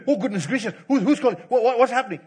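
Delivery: mock frightened boy. Preacher imitating startled Samuel, mock panic. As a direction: play the startled boy, half joking. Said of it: "Samuel's reaction".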